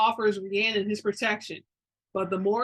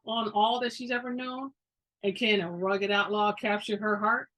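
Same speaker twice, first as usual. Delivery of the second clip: ordinary delivery, nothing marked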